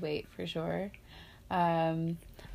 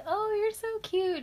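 much higher, slow sugary coo; cooing lady; slower than usual. Retold or imitated. Lady in a store cooing at the baby, high and slow. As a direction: gush like a stranger over a baby. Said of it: "a lady came over and like".

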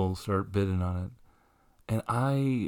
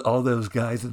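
usual speaking voice

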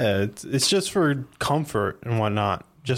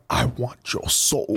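slow, eerie villain voice; slow sinister demand; Shang Tsung; much slower than usual. Retold or imitated. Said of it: "like the guy from Mortal Kombat".

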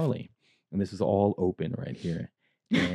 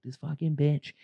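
pitched-up catty mimicry; mocking the insult; 6 semitones higher than usual. Retelling the insult the waitress overheard, in a pitched-up mock voice. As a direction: toss the insult off in a higher voice. Retold rude remark, pitched up.

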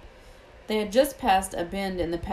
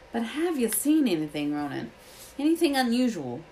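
raised pitch, probing question; lightly voiced Hagrid, questioning; 5 semitones higher than usual. A narrator's reading. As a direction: press the centaur for an answer.

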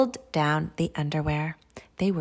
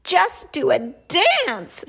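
high, drawn-out storybook delight; overjoyed; 7 semitones higher, 4 dB louder, slower than usual. Acted. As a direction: burst with joy, stretching the words high. Pea thrilled with new underwear.

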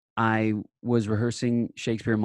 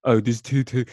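louder, mock-Shakespearean British; British accent; 2 semitones higher, 5 dB louder than usual. Shown as a demonstration. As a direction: demo of a stagey British accent. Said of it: "Well, my British accent".

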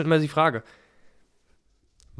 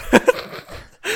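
breathy laugh; 5 dB louder than usual. Real, not acted. Laughs at podcast praise.

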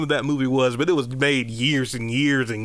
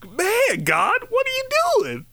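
very high-pitched incredulous protest; mock disbelief. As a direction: squeal the question up at God in mock dismay. Acted protest to God's command.

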